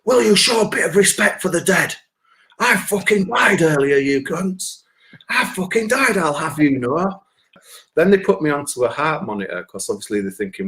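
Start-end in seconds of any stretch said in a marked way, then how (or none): 0.0-7.2 put-on high indignant yell at drunks; shouting. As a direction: shout it much higher, offended on behalf of the dead. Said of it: "I'm shouting at all the pissheads".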